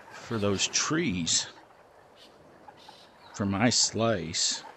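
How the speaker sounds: soft, low thinking aloud; murmuring to himself. Real, not acted. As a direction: mutter the plan quietly.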